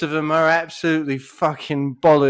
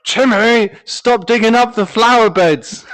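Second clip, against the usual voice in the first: louder and higher, telling off the dog; scolding dog owner; 6 semitones higher, 7 dB louder than usual. Pretend. Dog owner scolding the dog over flower beds, loud and high. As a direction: yell at Timmy like a cross owner in the garden.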